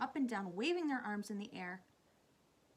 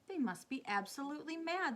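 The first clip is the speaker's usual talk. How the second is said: higher-pitched brash outburst; scoffing; 7 semitones higher than usual. A narrator's reading. Centipede scoffs at the Cloud Men.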